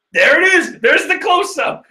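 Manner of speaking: high, loud thrilled cry; excited shout. Real, not acted. Manga reader spotting the big close-up, excited shout. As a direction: shout it up high in real delight. Hyped reading the clash panel.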